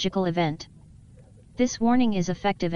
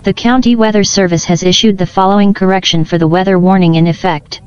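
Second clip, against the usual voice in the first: booming emergency PA voice, much louder; blaring public warning; loud alert announcement; 14 dB louder than usual. Acted. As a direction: announce the alert over a loudspeaker. Alert repeated at full volume.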